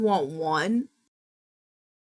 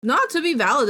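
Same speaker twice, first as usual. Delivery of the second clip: usual speaking voice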